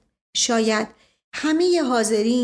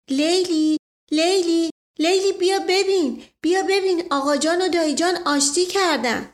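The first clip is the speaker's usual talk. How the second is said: excited shout; 7 semitones higher than usual. A narrator's reading. Boy calling excitedly to Leili through the house, joyful shout. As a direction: shout the news happily across the house. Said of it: "و فریاد زدم".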